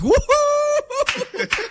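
high, laughing whoop; cheering woohoo. Retold or imitated. Host cheers the lawyer's line, high and laughing. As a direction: whoop like a fan in the stands. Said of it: "I was like".

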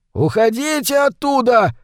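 high-pitched urgent yell; shouted warning. A narrator's reading. Bearded man yelling from the trawler, urgent high shout. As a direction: call out a warning, pitched well up. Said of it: "закричал с траулера какой-то бородач".

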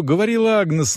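ordinary delivery

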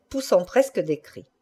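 plain reading voice

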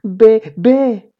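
put-on high, loud baby talk; high mock infant voice; pretend baby babble. A narrator's reading. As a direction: bleat out baby syllables.

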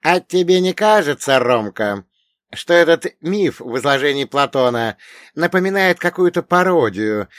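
put-on old dead mentor. A narrator's reading. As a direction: question your pupil as the old mentor. Old dead mentor questions the hero.

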